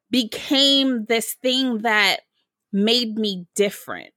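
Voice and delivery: normal talking voice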